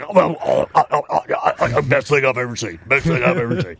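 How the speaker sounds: put-on dazed, breathless old man voice; stunned old man. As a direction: stammer it like someone awestruck. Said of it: "He's like".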